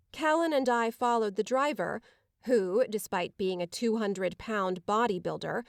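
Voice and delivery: ordinary delivery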